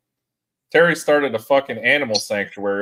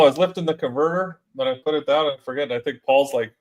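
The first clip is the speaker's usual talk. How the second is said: ordinary delivery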